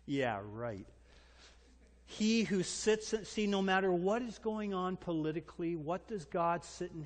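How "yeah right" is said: scoffing dismissal. Acted. Dad dismissing son's demand.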